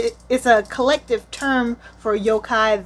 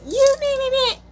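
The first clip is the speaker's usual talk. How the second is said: mock fury; 3 dB louder than usual. Girl about to go off.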